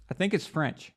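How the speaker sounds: usual speaking voice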